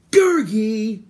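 high, loud angry shout of a name; furious cry. A narrator's reading. Terran catching the sneaking creature, furious. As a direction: shout it out in fury. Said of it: "Terran cried furiously".

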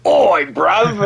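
loud, high British street greeting; mock British accent. Player retelling a failed Scottish attempt, mock British accent. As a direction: hail them in a hammed-up British accent. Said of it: "That's just more British".

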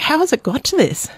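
incredulous reaction. Retold or imitated. Journalist recalling her reaction to the mayor's rudeness, incredulous.

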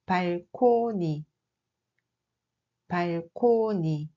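slow, careful "balcony" said as "palkoni" with a P; Korean loanword pronunciation. Shown as a demonstration. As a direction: model "balcony" slowly, as "palkoni". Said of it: "oui, c'est un balcon". ("balcony" prononcé lentement « palkoni »)

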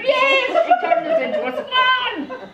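very high, loud comic squeal; squealing swan daughter. Acted. Swan daughter tells father about stepmother, high outraged squeal. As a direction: squeal the accusation in hammed-up outrage.

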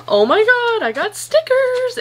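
high, delighted squeal; thrilled little shriek over stickers; excited squeal. Real, not acted. Opening package, delighted.

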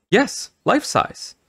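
high, slow, drawn-out announcement; proud declaration. A narrator's reading. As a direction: declare it proudly, stretching each word. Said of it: "Missus Caldwell declared".

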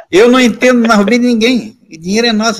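high-pitched acted self-defense; indignant protest. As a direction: protest your innocence to a parent. Son protesting innocence to parent.

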